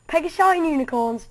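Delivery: loud, delighted cry of wonder; amazed exclamation. Acted. Doctor amazed at winged unicorns, loud and delighted. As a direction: blurt it out in awe.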